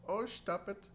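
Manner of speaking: high, coy mock girl voice. The TikTok girl being tickled, in a high coy mock voice. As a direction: giggle it out coyly. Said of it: "and they're like".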